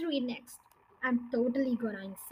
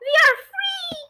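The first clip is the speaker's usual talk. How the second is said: much higher, louder gleeful squeal; joyful cry; 9 dB louder than usual. Acted. Little monkeys leaping out of the cage, gleeful cry. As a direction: burst out with glee at freedom. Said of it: "cried the two little ones".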